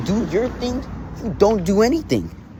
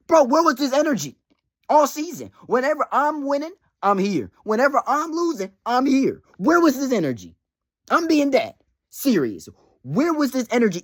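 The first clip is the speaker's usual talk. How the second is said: heated rant; 6 semitones higher than usual. Real, not acted. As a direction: demand where he's been all season. Ranting at rival manager.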